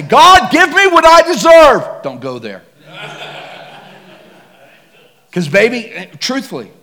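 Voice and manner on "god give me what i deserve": high, loud, cocky demand. Retold or imitated. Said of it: "get all cocky and come to God and say".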